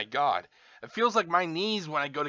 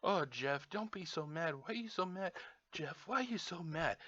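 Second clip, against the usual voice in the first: soft, hushed imitation of a chiding onlooker; gently chide, much softer than usual; small, quiet mock-soothing voice; mock concern; 11 dB quieter than usual. Voices onlookers chiding his anger.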